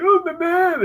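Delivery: very high whiny mimic of a kid; high mocking singsong. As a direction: parrot him back in a sissy whine. Bully mimicking whine.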